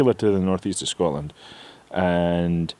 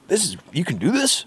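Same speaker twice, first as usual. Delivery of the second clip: retold amazement; 9 semitones higher than usual. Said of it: "And they went".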